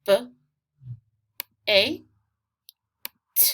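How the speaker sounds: b, a, t as three separate sounds with long pauses; isolated phonics sounds b, a, t; sounding out. Shown as a demonstration. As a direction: say each sound of "bait" alone: b, long a, t. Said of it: "Remember to sound this out".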